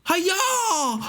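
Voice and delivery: very high drawn-out "hi-ya" yell; squeaky fighting shout; sword-swing battle cry. Pretend. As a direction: mimic a video game hero's attack cry. Imitates Link swinging a sword.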